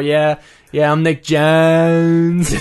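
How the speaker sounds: long held-out Pittsburgh O in Jones; Pittsburgh accent. Shown as a demonstration. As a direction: draw out the O in Jones, Pittsburgh style. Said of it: "I hold out my O's".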